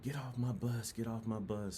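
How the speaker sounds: imitating an instructor yelling at recruits off the bus; mock drill instructor yell. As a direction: bark it like a recruit commander. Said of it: "and they're yelling".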